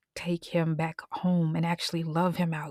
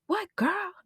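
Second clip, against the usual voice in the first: mother answering; 13 semitones higher than usual. Retold or imitated. Mother answers her daughter.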